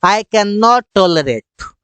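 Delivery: stretched out very slowly; slow clear English. Shown as a demonstration. Teacher giving the English phrase slowly. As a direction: drill it word by word. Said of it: "That's it".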